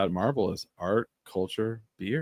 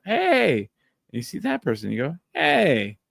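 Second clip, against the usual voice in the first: cheery greeting; 8 semitones higher than usual. Pretend. Greeting friends at bar.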